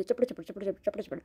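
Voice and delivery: soft rapid chapad chapad mimicry; chatter mimicry. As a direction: mimic the blabbering quietly.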